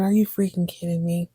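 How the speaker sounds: ordinary delivery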